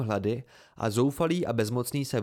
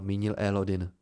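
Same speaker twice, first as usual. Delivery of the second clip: ordinary delivery, nothing marked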